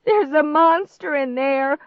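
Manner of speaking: high, loud panicky wail; frightened wail. A narrator's reading. Girl warns disbelieving stepbrother, wailing. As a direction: wail it out in alarm. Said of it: "I wailed".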